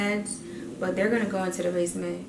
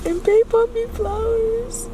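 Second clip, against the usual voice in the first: much higher and louder, bursting with excitement; thrilled; 7 dB louder, slower than usual. Real, not acted. Vlogger telling viewers babe bought her flowers, thrilled and high. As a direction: tell it like you can't believe it.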